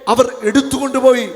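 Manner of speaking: loud, high wail of loss; anguished cry. Acted. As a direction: cry it out as the grieving Mary. Preacher voicing Mary's lament. (ഉച്ചത്തിലുള്ള വിലാപം)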